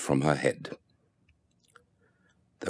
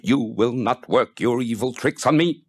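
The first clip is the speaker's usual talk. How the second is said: higher, louder defiant cry at the demons; 8 semitones higher, 3 dB louder than usual. A narrator's reading. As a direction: rap the cane and cry out against evil. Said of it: "cried out".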